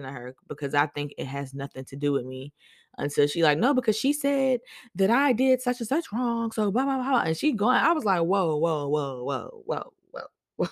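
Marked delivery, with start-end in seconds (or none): 3.6-7.2 mock whiny coworker. Imitating crybaby coworker's complaint. 8.2-10.7 calming whoa. Retold or imitated. Calming the crying coworker.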